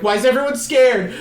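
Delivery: high, loud imitation of a distressed child; rising toward a mock scream; Charlie. Possessed mother speaking as dead daughter, alarmed. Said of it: "starts talking in Charlie's voice".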